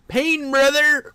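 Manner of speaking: loud, high, drawn-out mock anguish; hammed-up pain. New dad hamming up movie heartbreak, loud mock anguish. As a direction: wail it out slowly, overdoing the agony. Exaggerated repeat of the movie reaction.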